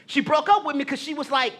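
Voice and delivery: ordinary talk, nothing marked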